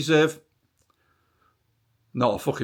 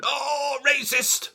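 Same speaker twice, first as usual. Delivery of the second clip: high mock outrage at the Labour lot; mocking shrill cry; 11 semitones higher, 3 dB quieter, much slower than usual. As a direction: squeal it like a hysterical heckler. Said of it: "jumping up and down, going".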